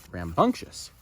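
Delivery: ordinary delivery, nothing marked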